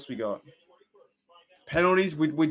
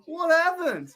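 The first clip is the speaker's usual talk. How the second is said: much higher, bursting out with a laugh; startled surprise; much slower than usual. Real, not acted. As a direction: caught completely off guard, voice jumping up. Sudden surprise raid arrives on stream.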